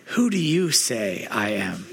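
slow, weighty, eye to eye; profound tone. Shown as a demonstration. Friend pulled aside asked with a profound tone. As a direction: ask it like it means everything. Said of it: "getting a real profound tone in your voice".

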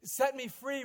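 loud, high desperate plea; a desperate prisoner begging God to free him; crying out. Retold or imitated. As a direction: cry out to God in anguish, pleading. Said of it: "he's crying out to Allah".

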